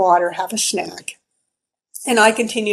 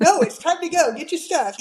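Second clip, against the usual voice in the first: imagined husband; 7 semitones higher than usual. Pretend. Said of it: "I'm imagining this conversation".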